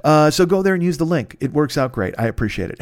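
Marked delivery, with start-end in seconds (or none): none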